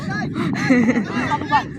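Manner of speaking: real burst of laughter. Real, not acted. Spectator amused at the game, open laughter. Laughing after own remark.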